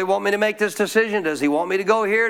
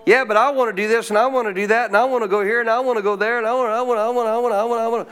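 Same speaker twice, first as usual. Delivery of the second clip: rattled-off wanting; 3 semitones higher, faster than usual. Acted. Preacher mimics believers arguing with God, rattling off wants. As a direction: pile up the wants without a breath. Acting out self-willed, piling up wants.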